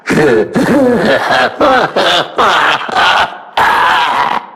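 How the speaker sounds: a drawn-out maniacal laugh; villainous laugh. Acted. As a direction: cackle with wild glee at his victim. Villain laughing at his victim.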